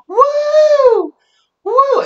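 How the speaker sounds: shrill-pitched party "woo!"; loud, high whoop imitating a girl showing off; high mock cheer. Mimics drunk girl cheering.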